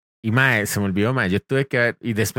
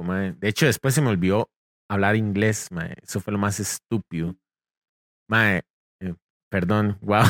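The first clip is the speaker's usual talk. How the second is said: usual speaking voice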